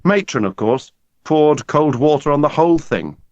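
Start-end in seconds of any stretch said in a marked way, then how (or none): none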